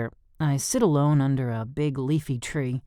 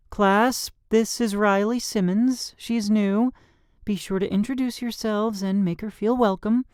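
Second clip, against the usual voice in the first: higher, brisk teacher voice; 9 semitones higher than usual. A narrator's reading. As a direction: address the class like a teacher. Teacher voice in story.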